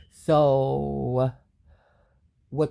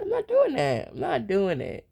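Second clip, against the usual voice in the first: emphatic refusal; 5 semitones higher than usual. Real, not acted. Refusing, pitch jumps high.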